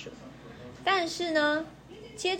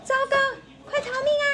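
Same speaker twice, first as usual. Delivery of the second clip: much higher, loud panicked cry; alarmed shout; 4 dB louder, much faster than usual. Acted. City mouse spotting the woman, screaming to flee. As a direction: yell at your friend to run for it. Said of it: "大叫".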